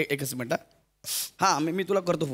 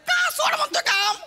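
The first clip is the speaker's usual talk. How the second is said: laughing; 12 semitones higher, slower than usual. Acted. Exaggerated puzzled outburst.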